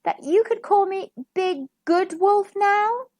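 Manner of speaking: high, hesitant wolf voice; shy. A narrator's reading. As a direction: ask timidly, hoping for approval. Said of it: "asked Big Bad Wolf shyly".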